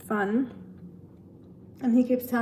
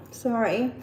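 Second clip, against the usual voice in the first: ordinary talk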